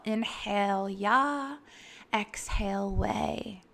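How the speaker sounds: slow, soft and drawn out, syllable by syllable on the breath; slow breath mantra. Shown as a demonstration. Guiding listeners through her breath mantra, slow and soft. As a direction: breathe the two syllables out gently. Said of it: "use it with my breath".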